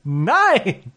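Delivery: high-pitched, emphatic mock-German cursing; Samuel Jackson as Hitler. Comic imagines the actor cast as Hitler, high mock outburst. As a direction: punch out the refusal high, as an impression. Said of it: "picturing Samuel Jackson as Hitler".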